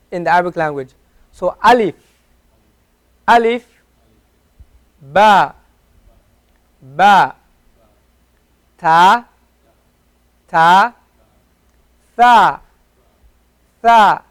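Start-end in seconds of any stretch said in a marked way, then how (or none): 1.3-3.7 alif with open a and clear ee; a teaching alif; alif modelled. Shown as a demonstration. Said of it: "then know it's alif". 5.0-14.2 ba, ta, tha each with an open a; paced letter drill; letter recitation. Shown as a demonstration. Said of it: "this gave us the a sound".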